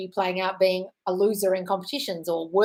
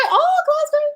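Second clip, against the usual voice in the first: 1 delighted squeal; 8 dB louder, slower than usual. Real, not acted. Delighted reaction to viewer.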